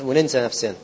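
normal talking voice

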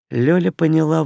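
usual speaking voice

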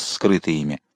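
ordinary delivery

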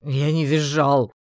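offended orc; loud denial. A narrator's reading. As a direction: insist loudly and slowly. Orc denies squealing.

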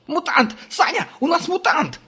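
high, piercing panicked shriek; shrill screech. A narrator's reading. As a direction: shriek it out in terror. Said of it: "заверещал".

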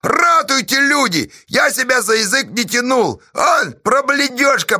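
very high, loud appeal; theatrical outcry. Acted. Pozdey pleading to the people, theatrical outcry. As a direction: cry to everyone for rescue.

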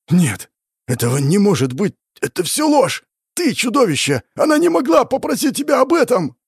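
high, furious outcry building in pitch; anguished denial. Acted. Demon accuses hero over the old woman, anguished outcry. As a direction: refuse to believe it, voice rising to a cry. Demon rejects the memory in fury.

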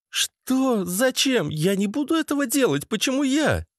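high-pitched, protesting female voice; indignant woman. Acted. Lena balks at massaging Dima, high indignant protest.

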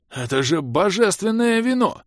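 high, stretched exclamation of awe; awed wheeze. A narrator's reading. The mage spots the divine wine, wheezing high. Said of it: "просипел черноволосый злоба".

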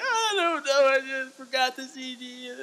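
put-on high whimpering kid excuse; crybaby voice. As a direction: whine it like a scared second-grader. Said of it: "I was a total crybaby bitch".

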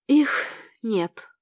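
subdued, sorrowful statement; gently mournful, falling away; sad. A narrator's reading. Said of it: "печально сказала она".

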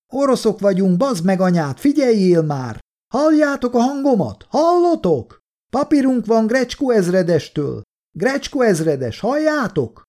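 high, insistent calls across open ground; angry, high-pitched yelling to be recognized; exasperated shouting. A narrator's reading. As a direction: shout to make yourself heard. Shouting at soldiers who keep firing.

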